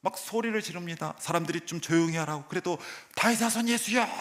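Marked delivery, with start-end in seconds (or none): 3.2-4.1 다윗 사손 예수여 cried out high and loud; retold shout. Blind man calling to Jesus, a raised mock shout. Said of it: "소리를 지르고 있어요".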